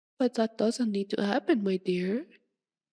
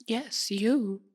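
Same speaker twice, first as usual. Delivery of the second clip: normal talking voice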